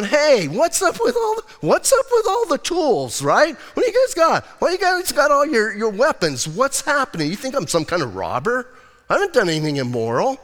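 high-pitched, incredulous mock questioning; mocking challenge. Preacher voices Jesus taunting the armed crowd, mocking. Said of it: "you know, kinda mocking them".

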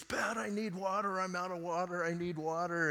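mock urgent plea on a high monotone; imitated calling out. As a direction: retell a friend calling out for water, without real volume. Friend urgently calling for water.

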